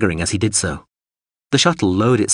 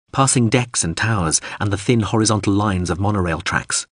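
usual speaking voice

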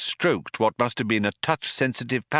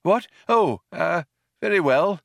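put-on higher, halting old man; falter, taken aback; slightly taken aback; 10 semitones higher, 3 dB louder than usual. A narrator's reading. Old man dismissed by mouse, slightly taken aback. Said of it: "slightly taken aback".

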